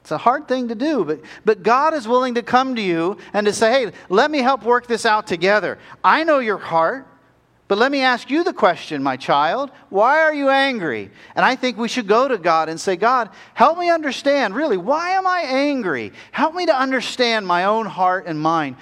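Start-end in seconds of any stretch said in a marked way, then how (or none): 3.7-11.1 high-pitched, insistent loving plea; earnest appeal. Acted. God urging his child to open up, earnest appeal. 12.9-18.8 high, urgent plea for understanding; pleading prayer. Acted. Believer asking God for help understanding anger, pleading prayer.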